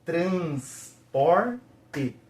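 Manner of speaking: syllable by syllable. Shown as a demonstration. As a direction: say "Transporte" slowly in three separate pieces. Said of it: "Conseguiu entender aí?".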